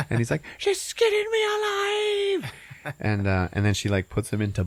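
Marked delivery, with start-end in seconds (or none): 0.6-2.5 very high, slow cartoon wail; tiny potato wailing in mock horror; squeaky horror. Potato being skinned.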